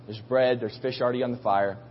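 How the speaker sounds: ordinary delivery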